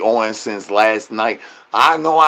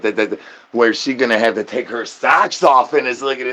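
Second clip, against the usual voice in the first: normal talking voice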